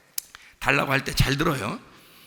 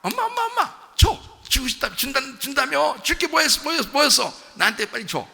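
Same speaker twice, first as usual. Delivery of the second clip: put-on high, excited child pestering a parent; gleeful begging; 11 semitones higher than usual. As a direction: act out a child happily nagging for a present. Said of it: "웃으면서 막". (신나서 조르는 아이 흉내, 높은 목소리)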